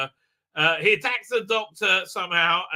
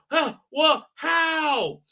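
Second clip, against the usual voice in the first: high, dim-witted bafflement; mock moron; 6 semitones higher, much slower than usual. Mimicking Doctor Moron faced with flying boat, high mock bafflement. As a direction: play her high and hopelessly baffled. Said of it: "Doctor Moron".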